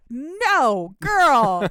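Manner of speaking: loud, drawn-out comic protest; exasperated, hammed-up yelling; mock-outraged shout. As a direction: holler it in playful horror. Scolds characters over lack of lube.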